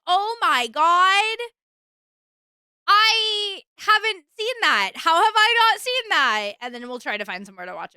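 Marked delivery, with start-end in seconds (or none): 0.1-6.5 slow, high astonished gasp; amazed disbelief, drawn out; surprised. Retold or imitated. Retold reaction to finding movie.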